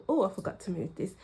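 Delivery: normal talking voice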